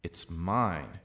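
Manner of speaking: "mine" swelling up and falling; emphatic "mine"; stress on mine. Shown as a demonstration. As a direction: land hard on the word "mine". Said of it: "added to the emphasized word".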